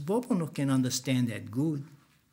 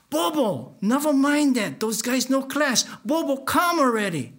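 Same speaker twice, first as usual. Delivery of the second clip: 1 calling out; 9 semitones higher, 4 dB louder than usual. Retold or imitated. Calling Bobo from curb.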